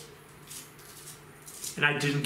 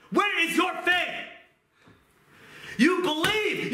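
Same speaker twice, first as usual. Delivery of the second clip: preaching at full force; 9 semitones higher than usual. Acted. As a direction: preach it hard, pleading with the flock.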